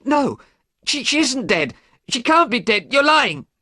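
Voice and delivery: high, loud denial; desperate shout. A narrator's reading. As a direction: refuse to believe it, shouting. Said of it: "Dorian shouted".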